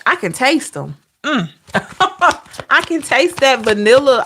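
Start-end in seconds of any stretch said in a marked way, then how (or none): 1.7-2.4 light laugh. Real, not acted. Laughing at tasting memory.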